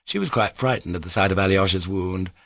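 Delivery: usual speaking voice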